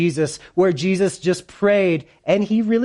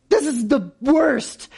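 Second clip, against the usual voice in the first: high, drawn-out mock wail; mock crying; 7 semitones higher, slower than usual. Preacher voicing Mary at the tomb, mock crying. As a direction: play at crying, slow and high. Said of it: "And she's just crying".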